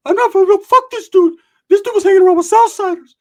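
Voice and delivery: high furious shout; angry yell. Retold or imitated. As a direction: scream it at the guy. Angry yelling in the retold fight.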